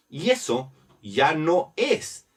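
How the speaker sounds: ordinary delivery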